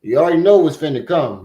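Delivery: usual speaking voice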